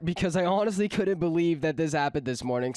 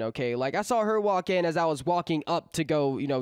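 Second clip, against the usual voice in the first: usual speaking voice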